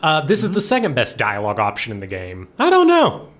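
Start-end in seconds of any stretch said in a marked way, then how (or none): 2.6-3.3 high, loud squeaky "I don't know". Pretend. Playful high character line.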